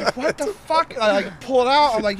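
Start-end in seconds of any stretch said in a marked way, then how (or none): 0.2-0.9 high-pitched incredulous reaction; mock shock, pitched way up without shouting; retold disbelief. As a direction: imitate dad's baffled outburst, much higher. Retold dad discovering the hamster.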